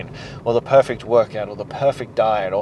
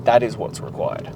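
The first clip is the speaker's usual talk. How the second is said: ordinary talk, nothing marked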